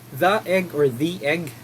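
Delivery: "The egg" first as thuh, then as thee, said slowly; thuh versus thee. Shown as a demonstration. Teacher shows both forms of the before egg. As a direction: set both ways of saying "The" side by side. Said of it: "Kailan natin sasabing the or the?".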